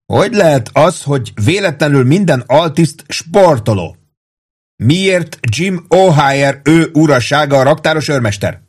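high, indignant rhetorical questions; worked-up sergeant's rant; bellowed tirade. A narrator's reading. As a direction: fire off accusing questions in outrage. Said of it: "üvöltötte".

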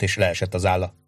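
ordinary delivery, nothing marked

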